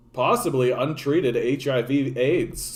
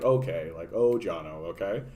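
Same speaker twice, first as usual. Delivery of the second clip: placating dismissal; 6 semitones lower, 4 dB quieter than usual. Retold or imitated. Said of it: "just saying it to be like".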